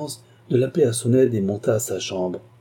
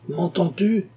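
sharp, much higher call; shouting; 10 semitones higher, slower than usual. A narrator's reading. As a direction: yell the question at the seated nephew. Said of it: "cria monsieur de Carquebus".

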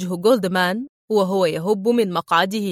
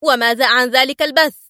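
higher-pitched, agitated question; mildly worked-up retort; nervy, irritated; 4 semitones higher than usual. A narrator's reading. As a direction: challenge with a touch of irritation. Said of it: "قال ديفيد في عصبية".

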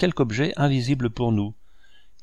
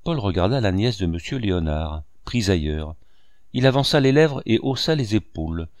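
ordinary delivery

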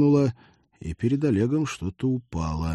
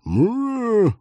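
frustrated growl; 6 dB louder than usual. Acted. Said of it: "вырвалось у сержанта".

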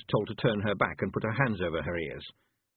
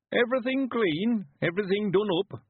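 put-on old man voice; 12 semitones higher, 3 dB louder than usual. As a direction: play a cheerful old villager.

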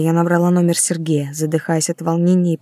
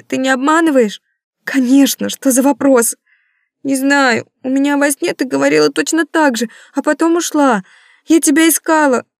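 put-on high, louder anxious child voice; worried child; 8 semitones higher, 4 dB louder than usual. A narrator's reading. Daughter tells mom about her bad dream, high anxious child voice. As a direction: plead like a frightened little girl.

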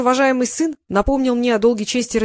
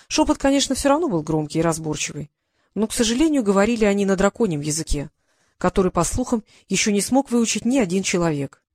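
ordinary delivery

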